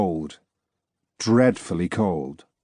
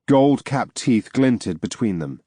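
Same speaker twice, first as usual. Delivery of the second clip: ordinary delivery, nothing marked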